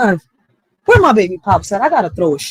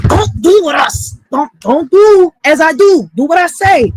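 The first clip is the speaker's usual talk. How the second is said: mock stern mom; 7 semitones higher, 7 dB louder than usual. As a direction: preach it like a parent scolding. Mom lecturing her sons.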